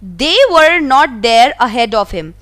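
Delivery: slow, careful read-aloud of the story. A narrator's reading. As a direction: read each word out slowly for the class. Reading the English story text. (धीमी आवाज़ में कहानी पढ़ना)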